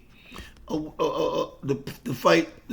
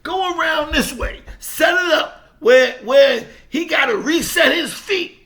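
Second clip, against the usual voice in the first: manager's instructions, pitched much higher and pushing louder; manager coaching; 10 semitones higher, 3 dB louder than usual. Retold or imitated. Manager telling the fighter how to circle, high and pressing. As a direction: drill the advice like a corner man. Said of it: "He said".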